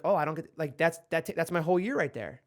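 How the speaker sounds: usual speaking voice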